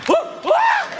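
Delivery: high squeaky whoas; whimpering whoas. Retold or imitated. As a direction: toss in a mock scared whimper. Mocking the guy's whimper.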